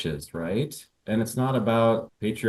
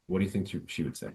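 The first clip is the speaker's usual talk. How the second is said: usual speaking voice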